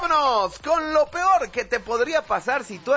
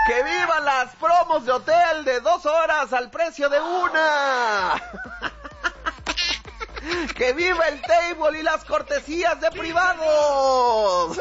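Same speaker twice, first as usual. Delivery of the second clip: high, sustained ceremonial cheers; rousing grito shouts; 6 semitones higher than usual. Acted. Nervous official saying what he shouldn't, shouting vivas. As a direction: proclaim each viva to the plaza.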